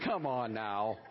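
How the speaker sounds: usual speaking voice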